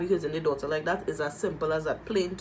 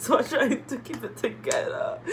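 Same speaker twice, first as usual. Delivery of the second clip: real crying; 4 semitones higher than usual. Real, not acted. Vlogger telling viewers she is trying not to cry, tearful. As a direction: voice breaking with tears while pushing on. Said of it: "I don't wanna cry because I've been crying".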